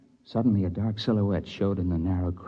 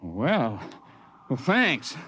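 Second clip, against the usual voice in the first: narrator's younger self in the crevasse; 6 semitones higher than usual. Acted. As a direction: shout up the ice wall, cheerful and relieved.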